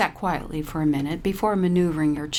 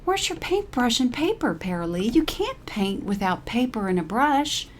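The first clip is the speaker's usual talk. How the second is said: higher gentle scolding friend; Caroline's voice; 7 semitones higher than usual. A narrator's reading. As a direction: chide her like a fussy friend.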